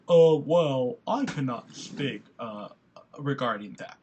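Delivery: lightly imitated nervous officer, slow and low. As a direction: mumble like an uneasy cop dodging the question. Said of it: "I wanted to see what he said".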